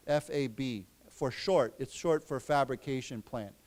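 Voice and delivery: ordinary delivery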